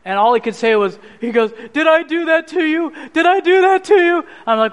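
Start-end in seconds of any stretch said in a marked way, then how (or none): 1.7-4.2 high, distraught pleading; retold tearful, anguished question; imitated bawling. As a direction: imitate a distraught father, pitch climbing on the repeat. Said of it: "he's bawling and he's upset".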